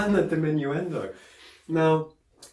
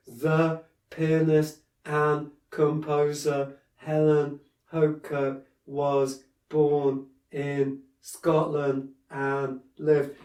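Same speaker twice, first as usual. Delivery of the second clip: stiff, slow reading; much slower than usual. Shown as a demonstration. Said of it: "you're sat there going".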